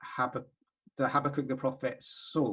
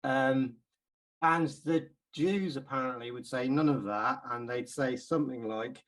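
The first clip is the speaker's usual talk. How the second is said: usual speaking voice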